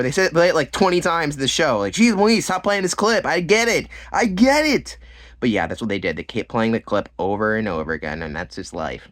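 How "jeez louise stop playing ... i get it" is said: high, loud mock exasperation, building to a squeal; hammed-up plea to make it stop; exasperated. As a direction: complain higher and higher, fed up. Mock exasperation at repeated clip.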